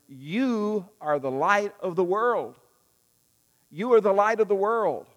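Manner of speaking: Jesus telling his followers who they are; preaching Jesus' words. Retold or imitated. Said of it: "he said to them".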